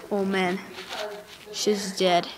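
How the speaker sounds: low, very soft voice; hushed, low remark; subdued realization. Real, not acted. Sees that she is dead.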